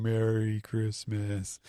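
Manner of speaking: slow, droopy Merry Christmas; depressed. Retold or imitated. Depressed crew mumbling greetings, slow and droopy. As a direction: drag it out sadly. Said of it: "like, depressed, going".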